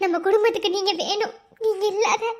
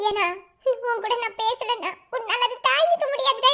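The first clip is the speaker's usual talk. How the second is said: ordinary delivery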